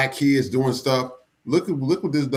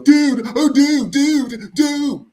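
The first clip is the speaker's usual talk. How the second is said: high, loud mock alarm; mimicked high-pitched freak-out; imitating video bystander; 7 dB louder, much slower than usual. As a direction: yelp it like the panicked kid in the clip. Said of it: "And we've seen the".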